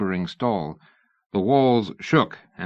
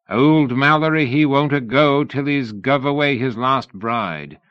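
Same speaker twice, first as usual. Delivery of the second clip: louder, higher, slow broad Sussex drawl; 5 semitones higher, 4 dB louder than usual. A narrator's reading. Mallory quoting his father in broad Sussex. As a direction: lay on the Sussex drawl as thick as you can. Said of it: "in his broadest Sussex drawl".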